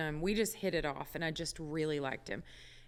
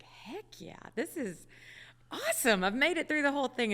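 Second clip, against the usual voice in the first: higher, triumphant inner cheer; excited; 8 semitones higher than usual. Retold or imitated. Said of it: "I'm like".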